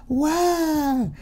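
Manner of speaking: high breathy gasp of awe; gasping wow. Acted. Mock awe at fan art.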